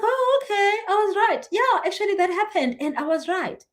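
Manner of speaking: squeaky voice imitating a client finally convinced; high mock client voice.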